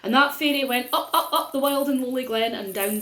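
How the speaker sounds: ordinary delivery, nothing marked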